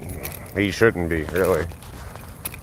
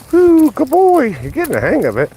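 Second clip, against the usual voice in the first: high praise; 8 dB louder than usual. Real, not acted. Owner cooing at dog learning the cones, high excited. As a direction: coo it out delighted.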